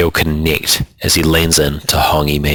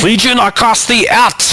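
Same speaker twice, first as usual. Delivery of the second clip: much higher, fervent cry; shouting exorcism. A narrator's reading. Old Kaumatua shouting an exorcism in Abdul's face, fervent cry. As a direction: cast the demon out with a shout. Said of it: "he shouts in Abdul's face".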